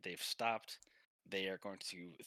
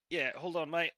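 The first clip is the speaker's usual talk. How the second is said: put-on higher, louder Australian accent; Pilfer; 7 semitones higher, 6 dB louder than usual. Pilfer asks the masseur to wait, Australian accent. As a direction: call it out like an Aussie mate. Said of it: "Pelfer goes".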